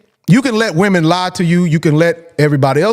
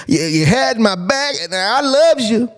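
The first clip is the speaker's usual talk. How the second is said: a hammed-up woman crooning her love; mock sweet-talking girlfriend; 4 semitones higher than usual. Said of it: "They'll tell you they love you all day".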